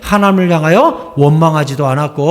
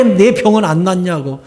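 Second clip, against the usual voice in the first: ordinary talk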